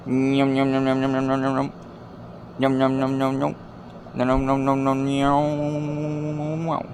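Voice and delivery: goofy yum-yum eating sounds; sing-song nom nom at the end; munching noises. Pretend. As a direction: chant it like a creature gobbling.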